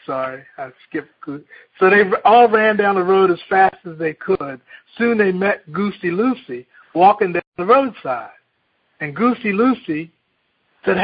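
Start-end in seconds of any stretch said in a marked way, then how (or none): 1.8-11.0 put-on lively read-aloud to children; storyteller reading a picture book; story reading. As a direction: tell the fable with bright animal voices. Reads Chicken Little aloud.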